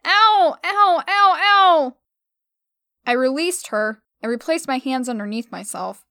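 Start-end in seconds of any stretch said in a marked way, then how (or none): 0.0-2.0 high loud ow cries; pained yelps. Acted. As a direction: yelp at each pull. Hair being yanked.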